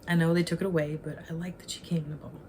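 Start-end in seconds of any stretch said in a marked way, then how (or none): 1.0-2.4 sudden whisper, a bit quicker; whispered aside. Real, not acted. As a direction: whisper it like a secret. Secret excited aside.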